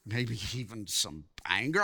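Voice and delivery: low, soft and tentative; hushed suggestion. Real, not acted. Preacher cautiously guesses at the sisters' feelings, hushed. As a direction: drop the voice to venture it carefully.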